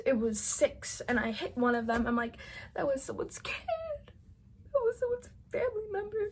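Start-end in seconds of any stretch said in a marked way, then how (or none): 2.7-6.3 crying. Retold or imitated. Telling her mom about the deer's family, breaking down crying. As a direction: voice rising high and breaking into tears. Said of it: "And I just start sobbing".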